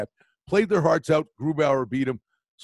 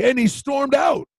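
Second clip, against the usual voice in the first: louder, higher, slow emphatic shout; 10 semitones higher, 6 dB louder, slower than usual. Real, not acted. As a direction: belt out the punchline. Dramatic climax of story.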